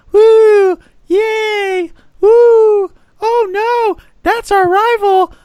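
squeaky, loud Mickey Mouse-like falsetto; loud falsetto mock woos and oh-nos; high-pitched mock cheering. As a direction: put on a very high, childish voice to mock fans. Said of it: "Why the high-pitched voice?".

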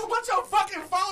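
high, slow mock yell; high loud slowed retold yell; retold call-out. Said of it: "I said".

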